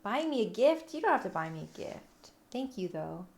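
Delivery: ordinary talk, nothing marked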